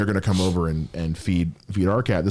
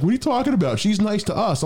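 much higher-pitched protesting voice; mock indignant relatives. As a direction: imitate family defending the cat in high disbelief. Acts out disbelieving family.

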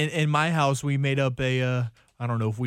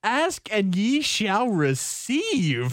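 slow solemn recitation; 7 semitones higher, much slower than usual. Pretend. Mock scripture quote.

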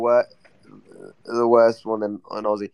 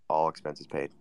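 ordinary delivery

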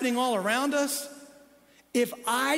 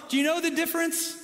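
ordinary talk, nothing marked

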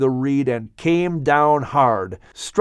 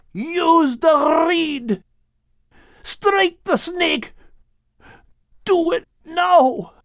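high, slow desperate shout; yelling; much slower than usual. Acted. Dying dog yelling orders at Shem, high urgent shout. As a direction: yell each order out, pitched far up. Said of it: "yelled to Shem".

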